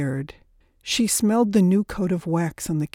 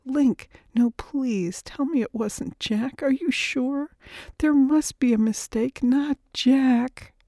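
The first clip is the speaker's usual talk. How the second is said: high-pitched, disbelieving plea; desperate pleading; 7 semitones higher than usual. Acted. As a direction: beg for it to be untrue, voice raised in pitch. Widow begs that news be untrue.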